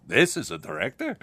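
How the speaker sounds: a hint of an incredulous Zero Mostel; lightly mock scoffing question; Zero Mostel impression. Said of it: "As Zero Mostel said to Mel Brooks".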